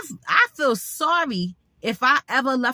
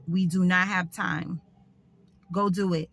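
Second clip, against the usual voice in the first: ordinary talk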